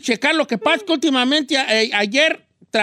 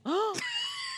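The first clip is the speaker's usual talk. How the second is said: huge shocked gasp; dramatic gasp; 13 dB quieter than usual. Pretend. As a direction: gasp as if heartbroken. Hearing no funds.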